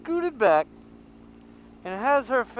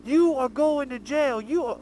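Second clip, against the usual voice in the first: flipping out; 7 semitones higher than usual. Retold or imitated. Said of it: "flipped out and was like".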